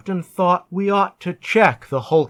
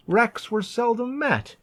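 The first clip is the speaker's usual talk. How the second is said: ordinary delivery, nothing marked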